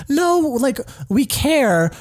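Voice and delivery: high-pitched, insistent pushback; mock protest. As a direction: protest it high, as if wrongly accused. Voicing New Yorkers protesting they care.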